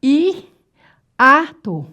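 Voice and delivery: "I, ato" split after the I; syllable by syllable. Shown as a demonstration. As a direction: separate "I" from "ato" with a clear pause. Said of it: "Ó, separando sílaba".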